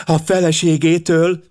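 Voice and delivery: put-on high, excited girl out of breath; panting. A narrator's reading. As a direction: gasp it out eagerly, high. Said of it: "lihegte Pamela Cregan". (magas, lihegő lányhang)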